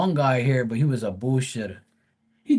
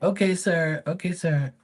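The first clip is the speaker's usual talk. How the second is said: high, quick and agreeable; polite pool guy voice; 6 semitones higher than usual. Retold or imitated. Pool guy agreeing to the homeowner's plan, high and polite. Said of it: "And he's like".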